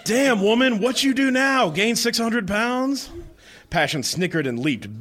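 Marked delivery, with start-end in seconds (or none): 0.0-3.1 mock outrage. Mocking reaction in dramatic reading.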